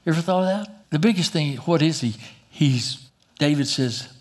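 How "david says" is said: ordinary delivery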